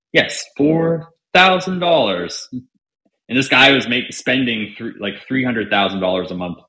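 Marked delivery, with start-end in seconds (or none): 0.1-2.5 excited. Retold or imitated. Thrilled at first big fee.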